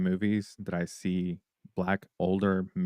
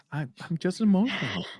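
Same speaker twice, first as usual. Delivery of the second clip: mock-weepy voice, pitched up; teary wife imitated; 11 semitones higher, 3 dB louder than usual. Husband imitates teary wife on the couch, mock-weepy. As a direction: imitate her, overdone and a little whiny. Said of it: "I'm like".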